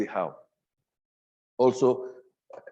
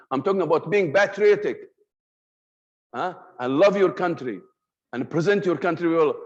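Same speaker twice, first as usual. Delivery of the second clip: higher and louder, full of conviction; with passion; 4 semitones higher, 5 dB louder than usual. Shown as a demonstration. Teacher showing the same patriotic message with passion, loud and strong. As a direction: push it out like you believe every word. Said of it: "You understand now?".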